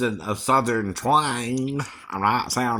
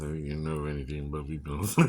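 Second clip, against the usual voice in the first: much lower and softer, quick; quieter aside; 10 semitones lower, 10 dB quieter, faster than usual. Shown as a demonstration. Singer repeating the line as a low, soft knowing aside. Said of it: "repeated line".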